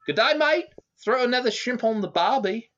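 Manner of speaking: high, loud mock Australian accent; a cartoon Australian dragon, hammed-up in a high Aussie accent. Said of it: "nobody does a better Aussie voice than yours truly".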